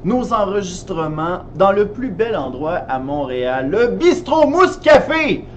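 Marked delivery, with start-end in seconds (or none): 3.7-5.4 bright, drawn-out venue reveal; excited announcement. Acted. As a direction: announce the café with flair. (annonce enthousiaste)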